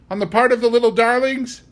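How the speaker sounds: the housekeeper can't believe it; shocked disbelief. A narrator's reading.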